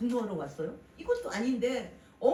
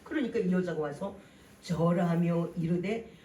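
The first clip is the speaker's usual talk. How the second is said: usual speaking voice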